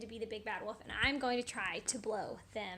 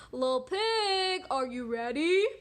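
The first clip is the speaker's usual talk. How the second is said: wolf calling out; 3 semitones higher, 10 dB louder than usual. Acted. Wolf calling at the little pig's house, sing-out call. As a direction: call at the door, stretching each word. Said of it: "the wolf came to the little pig's house and said".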